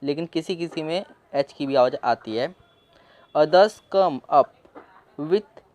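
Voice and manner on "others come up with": slow reading. Shown as a demonstration. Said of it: "reading slowly to learners".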